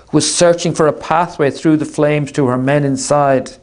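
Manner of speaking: usual speaking voice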